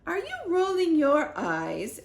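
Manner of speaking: suspicious queen. A narrator's reading. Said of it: "asked the Queen".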